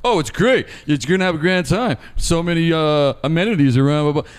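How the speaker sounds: mean the opposite of every word; sarcastic. Retold or imitated. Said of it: "the most sarcastic fucking".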